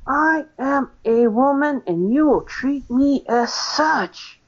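retold outburst, putting him in his place; retold angry scream. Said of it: "I actually screamed right back".

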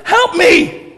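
high, loud urgent cry for rescue; desperate plea. Pretend. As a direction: cry out to Jesus as if in danger. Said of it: "and go".

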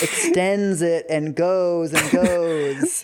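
very slow, stretched emphasis; exasperated drawn-out words. Real, not acted. Co-host complains the writer's paragraphs never end, exasperated and dragging. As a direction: drag it out to show how endless it is.

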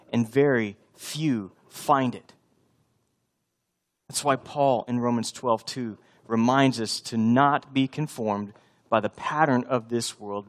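ordinary delivery

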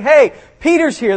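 high-pitched imitation of breathless news; hammed-up eager call to the group; excited announcement. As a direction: act out an excited servant girl. Servant girl excitedly telling everyone.

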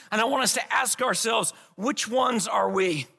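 normal talking voice

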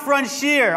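"frontier" said as "frun-SHEER"; mispronounced frontier. Shown as a demonstration. Pastor showing congregation the wrong way, mispronounced frontier. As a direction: say "frontier" with a sh sound in the middle. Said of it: "So it's not frontier".

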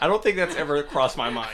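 amused, laughing reply. Real, not acted. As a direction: laugh while you answer. Laughing at the British accent joke.

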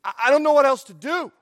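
high, loud exasperated outburst; at wit's end. Retold or imitated. Preacher voicing perplexed Paul, at wit's end. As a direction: stammer into it, throwing up your hands. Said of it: "to be at one's wit's end".

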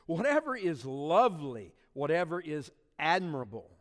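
slow, each "whatever" stressed in turn; rhythmic emphasis. Acted. Preacher listing virtues to the church, each "whatever" stressed. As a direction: hammer each "whatever" like a drumbeat.

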